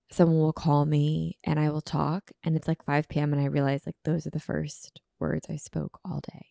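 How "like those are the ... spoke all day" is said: hushed whisper. Real, not acted. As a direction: drop to a whisper. Whispered aside.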